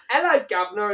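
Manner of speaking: high, loud Cockney hello called across the room; shouted mock Cockney. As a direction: shout it like an over-the-top London cabbie. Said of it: "started shouting out".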